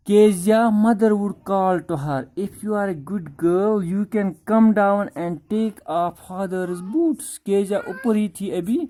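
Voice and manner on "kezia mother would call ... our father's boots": slow, deliberate reading of the text; reading the story line by line; slow reading aloud. Shown as a demonstration. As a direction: read each word out slowly for the class. Rereads the English text.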